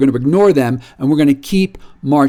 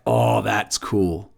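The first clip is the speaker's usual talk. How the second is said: hushed, drawn-out "Oh, that's cool"; soft, slow aside; 7 semitones lower, 6 dB quieter, much slower than usual. Retold or imitated. Quietly impressed by the Argentines' flyer, slow and hushed. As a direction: let it slip out low and slow, half to yourself.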